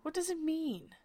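high, loud, put-on snapping voice; loud, high Humpty Dumpty voice, cutting in; impatient egg man. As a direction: demand it impatiently in a pompous character voice. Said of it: "interrupted impatiently".

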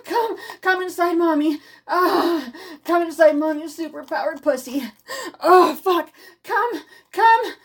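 high, loud cries of pleasure; climax moans. Acted. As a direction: let it peak, crying out high. Climax, crying out.

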